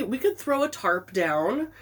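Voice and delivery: usual speaking voice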